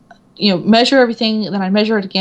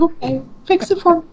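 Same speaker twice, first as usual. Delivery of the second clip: sheepish surrender; 9 semitones higher than usual. Retold or imitated. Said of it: "I'm like".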